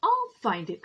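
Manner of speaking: loud determined cry; loud, stubborn vow. A narrator's reading. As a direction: vow it at the top of your voice. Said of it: "cried Horton".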